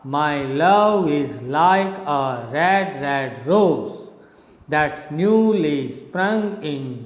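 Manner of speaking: slow, measured verse, swelling on LOVE, LIKE, RED, ROSE; rising iambic rhythm. Shown as a demonstration. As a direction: go from down to up on each foot, "my LOVE is LIKE". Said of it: "it's a rising rhythm. From down you go up".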